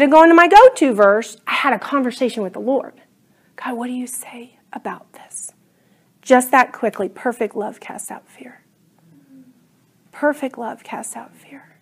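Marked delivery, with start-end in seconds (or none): 3.6-5.5 whispered question to God; whispered plea. Retold or imitated. Asking God in great fear. 7.1-11.8 whispered divine reply; whispered answer. Retold or imitated. God's answer, repeated.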